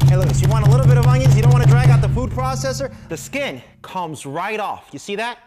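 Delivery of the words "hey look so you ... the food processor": loud, fast, high sales blast; excited pitch. Acted. Pitchman urging viewers, loud fast pitch. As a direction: hurry them along, loud and quick. Pitchman hyping onion chopping.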